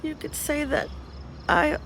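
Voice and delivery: soft, weak self-reproach; hushed and defeated; despondent whisper. Acted. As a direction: confide it faintly, close to whispering. Distressed, confiding in a friend.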